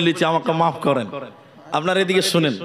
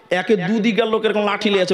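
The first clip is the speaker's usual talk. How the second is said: ordinary delivery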